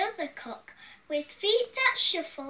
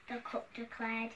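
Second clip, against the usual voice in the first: usual speaking voice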